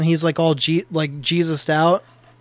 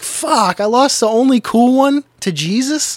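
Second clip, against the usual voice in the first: high, loud exasperated disbelief; mock dismay; 7 semitones higher, 4 dB louder, slower than usual. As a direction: whine it like the best friend was stolen. Retelling his dismay at friend.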